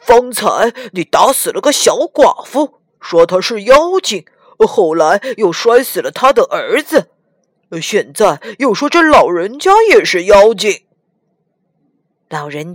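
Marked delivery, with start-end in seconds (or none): 0.1-10.9 louder, accusing pig voice; Pigsy indignantly accusing his brother; resentful complaint. Acted. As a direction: grumble angrily, piling up the charges. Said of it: "愤愤地说".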